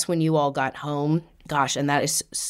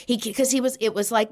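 ordinary delivery

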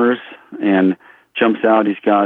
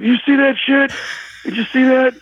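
very high, strained imitation of wild amazement; Chris Farley. Director to onlookers after the shooting, crazed amazement. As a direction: squeal it in crazed disbelief. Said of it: "It's like Chris Farley, he's going crazy".

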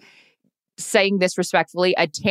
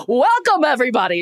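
welcome, everybody yelled out much higher and louder; mock yelled welcome; 6 semitones higher, 3 dB louder than usual. Shown as a demonstration. Podcaster mocking hosts who yell into the mic, hammed-up shouted welcome. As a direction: blast the greeting like an overexcited host. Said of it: "Why are we yelling?".

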